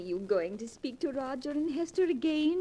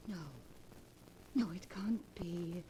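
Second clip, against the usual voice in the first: breathless whisper of disbelief; hushed, stunned whisper; horrified whisper; 9 semitones lower, 15 dB quieter than usual. Acted. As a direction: barely breathe the words in shock. Reading terrible news.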